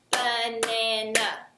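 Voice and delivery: "Banana" said slow as ba-na-na, one beat each; clapping syllables. Shown as a demonstration. Teacher clapping out banana's syllables for children. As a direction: stretch "Banana" into three clapped beats. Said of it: "Let's clap out how many sounds we hear in banana".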